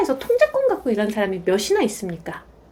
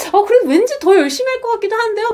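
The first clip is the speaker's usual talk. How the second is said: higher, quick, half-joking audience reply; playful quoted reply; 7 semitones higher, faster than usual. Retold or imitated. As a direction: toss it off lightly, a little higher.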